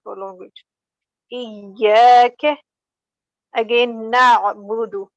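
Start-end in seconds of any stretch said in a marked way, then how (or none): 1.3-2.6 Iyaka with long ya and short ka; correct prolongation. Shown as a demonstration. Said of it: "we have to prolong the sound of ya". 4.1-5.1 na'budu with the final du kept short; correct short ending. Shown as a demonstration. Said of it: "So it will be na'budu".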